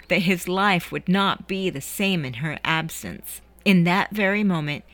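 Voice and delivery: ordinary delivery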